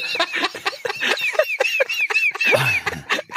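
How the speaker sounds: squealing burst of laughter; high shrieking laugh. Real, not acted. Cracks up at the joke.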